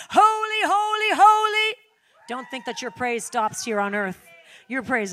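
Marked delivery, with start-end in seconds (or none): 0.1-1.8 high, loud holy chant; exultant holy, holy; ecstatic worship cry. Pretend. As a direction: cry it out like angels in heaven. Acting out heavenly worship.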